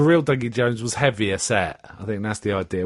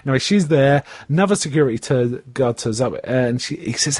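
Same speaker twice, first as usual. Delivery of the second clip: normal talking voice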